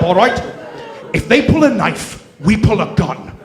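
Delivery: loud Scottish Connery voice; Connery as Scrooge. Pretend. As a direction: growl the orders like Connery. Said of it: "Sean Connery as Uncle Scrooge".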